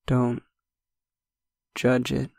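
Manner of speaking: usual speaking voice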